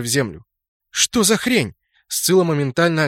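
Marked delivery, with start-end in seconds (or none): 0.9-1.7 higher alarmed exclamation; startled. Acted. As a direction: blurt out in shock. Sudden arrow kills mages.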